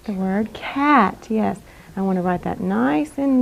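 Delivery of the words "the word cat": slow. Shown as a demonstration. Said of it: "the word that I said slowly".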